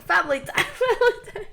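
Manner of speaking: high squeaky mock voice collapsing into laughter; imitating Russian guy, laughing. Listener repeating the guy's line about dad, squealing with laughter. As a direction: mimic him while cracking up. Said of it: "his arm around your dad's shoulder being like".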